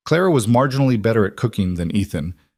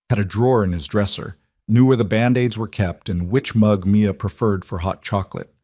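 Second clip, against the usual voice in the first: ordinary delivery, nothing marked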